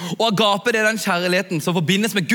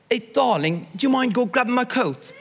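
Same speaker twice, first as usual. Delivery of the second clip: higher, sweet British English ask; British fiancée; 5 semitones higher than usual. Retold or imitated. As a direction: lightly play the British fiancée asking a favour. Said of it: "Og så sier hun sånn". (forloveden ber søtt om jakka, lett britisk stemme)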